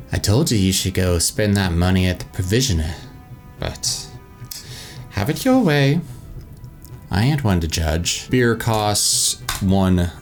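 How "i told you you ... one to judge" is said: lightly voiced orc barkeep; a hint of a tavern orc woman; female orc voice. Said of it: "how to do a female orc's voice".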